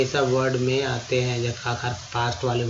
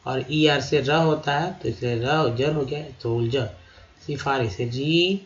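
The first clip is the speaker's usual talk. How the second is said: ordinary talk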